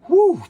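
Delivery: high, loud whew; sigh of relief. Pretend. Reviewer relieved at the comic, big exhaled whew. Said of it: "big sigh of relief".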